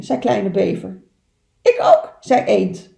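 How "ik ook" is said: very high, loud chime-in; eager duck voice. Acted. Duck joining in to befriend the otter, high excited voice.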